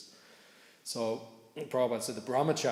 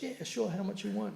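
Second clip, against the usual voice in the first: much higher, breezy yeah sure; casual agreeing guy; 11 semitones higher than usual. Retold or imitated. Driver happily agreeing to donate, much higher and breezy. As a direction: play the easygoing driver. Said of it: "The guy goes".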